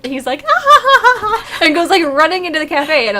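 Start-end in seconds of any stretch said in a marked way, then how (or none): 0.5-1.6 high, loud imitation of a delighted kid; gleeful little kid. Little kid running into the cafe, gleeful. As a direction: giggle like a child running wild. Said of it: "look of just pure elation".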